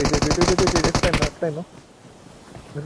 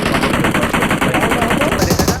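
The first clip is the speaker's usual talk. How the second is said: very slow, stretched, louder and higher; drawn-out loud call; 4 semitones higher, 6 dB louder, much slower than usual. Real, not acted. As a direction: drag the words out as a loud call. Urging teammate to their drop.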